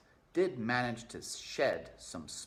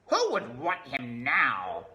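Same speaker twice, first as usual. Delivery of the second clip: slow, loud scornful rat voice; disdainful rat Botticelli; sneering rat; 4 semitones higher, 9 dB louder, slower than usual. Acted. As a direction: scoff at the mouse.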